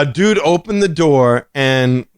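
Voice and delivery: ordinary talk, nothing marked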